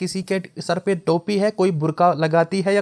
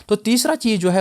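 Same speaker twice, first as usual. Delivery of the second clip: normal talking voice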